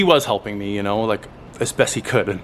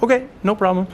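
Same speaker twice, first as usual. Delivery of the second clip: higher, cheery okay from the lady; lightly voiced friendly clerk; 10 semitones higher, faster than usual. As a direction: mimic the helpful clerk. Voicing the ticket lady.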